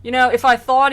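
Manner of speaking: mock dismay. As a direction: fake concern with a sigh. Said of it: "Virgil is, like, just like".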